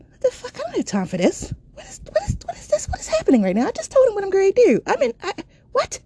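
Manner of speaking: high, stammering what-is-happening; flustered disbelief. Retold or imitated. She stares at the phone, high-pitched and stammering in disbelief. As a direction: sputter in shocked confusion. Stunned at being yelled at.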